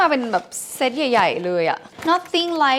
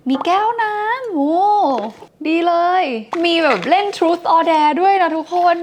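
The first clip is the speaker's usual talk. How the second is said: delighted unboxing; 7 semitones higher, 4 dB louder, slower than usual. Real, not acted.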